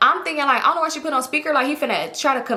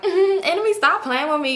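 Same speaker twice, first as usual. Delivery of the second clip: playful scolding; 7 semitones higher than usual. Retold or imitated. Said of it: "I'm just laughing".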